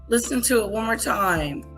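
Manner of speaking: ordinary talk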